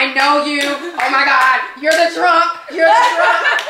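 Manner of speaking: loud starstruck teasing, half laughing; excited fan shout. Retold or imitated. Desk girl shouting recognition at the actress, loud laughing excitement. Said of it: "the girl sitting at the registration desk was like".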